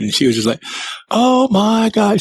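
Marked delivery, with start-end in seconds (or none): 1.1-2.2 mock excited scream. Daughter-in-law just saw a Bigfoot, mock excited scream. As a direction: imitate a thrilled scream, held back in volume. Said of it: "She's just screaming".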